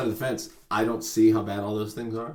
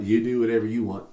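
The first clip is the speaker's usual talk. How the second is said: normal talking voice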